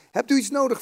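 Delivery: read-aloud helpful question; eager question. A narrator's reading. Boy asks the priest in story.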